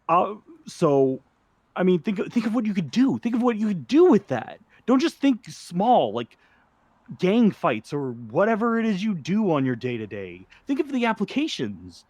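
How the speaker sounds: high-pitched, swooping enthusiasm; impassioned pitch. Acted. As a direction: urge them on, voice jumping high. Acted scientist pleading his discovery's potential.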